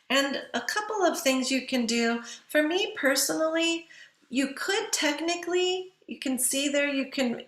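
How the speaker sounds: normal talking voice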